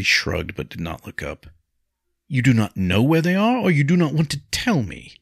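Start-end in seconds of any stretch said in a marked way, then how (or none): 2.3-5.1 loud, high insistent question; demanding. A narrator's reading. Nobleman pressing a wife for his brothers' whereabouts, demanding. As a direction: press hard for an answer.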